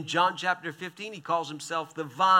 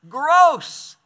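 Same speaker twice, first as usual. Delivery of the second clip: a loud, high, mock-disgusted cry; 9 semitones higher, 11 dB louder than usual. The crowd recoiling from eating flesh, loud mock disgust. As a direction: spit out the word like something tastes bad.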